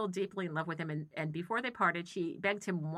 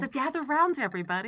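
storyteller; 7 semitones higher, 5 dB louder than usual. Acted. Campfire storyteller.

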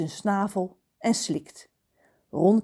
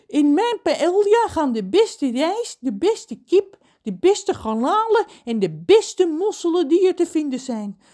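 bragging cook; 9 semitones higher, 5 dB louder than usual. Acted. Cook John brags about his paella ingredients. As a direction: savour each ingredient proudly.

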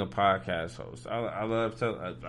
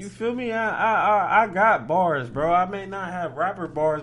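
high, loud hype about his bars; real worked-up boast; hyped defence; 8 semitones higher, 8 dB louder than usual. Real, not acted. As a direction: fire up and defend yourself loudly.

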